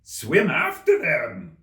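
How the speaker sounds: loud, slow mock scream. A narrator's reading. The Gryphon screams the next step, loud. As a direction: scream it out with glee. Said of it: "screamed the Gryphon".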